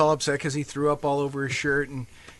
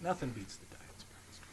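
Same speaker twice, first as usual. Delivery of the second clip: mock whisper; 2 semitones lower, 14 dB quieter than usual.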